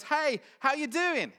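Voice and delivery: high, loud and slow, a bright passing hello; breezy American greeting. Retold or imitated. Crew member greeting the new German recruit, loud breezy hello. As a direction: toss a loud cheery hello while walking past.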